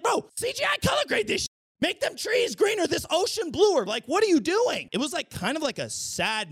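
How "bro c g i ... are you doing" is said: exasperated shout. Retold or imitated. Ranting at Disney about the dull colors, exasperated high shout. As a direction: bark it like you're fed up with their color grading.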